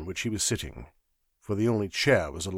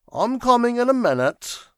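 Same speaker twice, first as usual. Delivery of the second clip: articulate yawn; 13 semitones higher, 6 dB louder than usual. A narrator's reading. Secretary answering from the ledge above, yawning lazily. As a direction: call back through a lazy yawn. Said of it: "suggestive of an articulate yawn".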